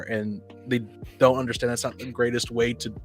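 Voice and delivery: ordinary delivery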